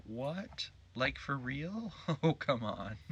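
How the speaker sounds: high, slow disbelief "for real?"; surprised. Real, not acted. Gamer reacts to the Colonel's offer, high disbelieving. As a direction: pitch shoots up in disbelief.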